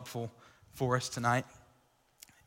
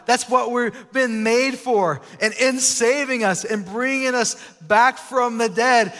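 fired-up preaching; 7 semitones higher, 7 dB louder than usual. Acted. Pastor preaching to the youth group, fired up and loud. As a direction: preach it with full conviction.

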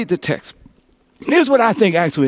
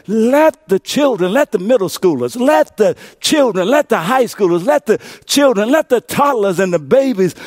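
preaching Jesus' words; 4 semitones higher than usual. Acted. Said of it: "he said to them".

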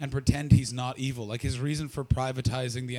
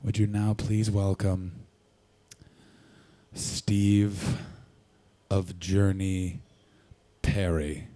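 flat, emotionless low intro voice; bored guitarist mumbling an introduction; lackluster announcer; 7 semitones lower, much slower than usual. Retold or imitated. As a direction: introduce him like you couldn't care less. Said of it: "You've never seen a lackluster, emotionless man".

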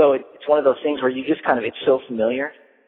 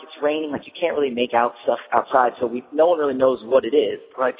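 normal talking voice

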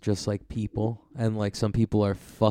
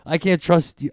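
mock tough guy; 6 semitones higher, 6 dB louder than usual. Friend hams up the tough guy.

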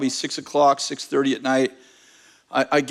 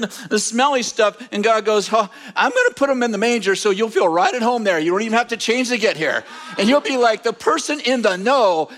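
raised, driving pulpit voice; impassioned preaching; 6 semitones higher than usual. Real, not acted. Preacher assuring shepherds they won't miss Jesus, impassioned preaching. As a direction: preach it high and insistent, rolling on without letup.

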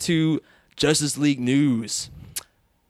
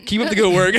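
laughing read; 6 semitones higher, 6 dB louder than usual. Real, not acted. Rereads review line laughing.

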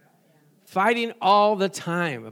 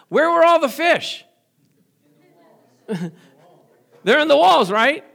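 laughing, higher and louder; excited laughing; 8 semitones higher, 8 dB louder than usual. Real, not acted. Preacher to congregation, excited laughing wonder. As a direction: marvel out loud at the fish walls.

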